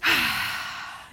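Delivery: long, audible demo sigh; deep breath out. Shown as a demonstration. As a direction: show the kids a slow calming exhale. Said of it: "sometimes we have to take a deep breath".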